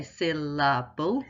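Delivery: "syllable" split into three distinct beats; teacher's careful three-beat "syllable". Shown as a demonstration. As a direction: say "syllable" in three separate parts, syl-la-ble. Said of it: "jaw moves three times because there's three syllables".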